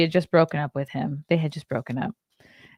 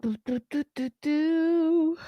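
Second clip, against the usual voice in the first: 1 yawning; 10 semitones higher, slower than usual. Real, not acted. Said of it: "Sorry, I'm yawning".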